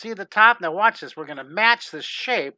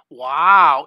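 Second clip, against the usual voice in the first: surprised wow; 3 semitones lower, 11 dB louder than usual. Real, not acted. Reacting to a viewer in Egypt.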